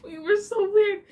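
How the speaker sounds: high, loud, laughing through the words; helpless laughter at herself; real laughter. Real, not acted. Storyteller remembering siblings' candle game, laughing.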